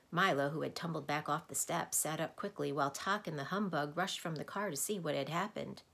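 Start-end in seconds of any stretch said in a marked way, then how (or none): none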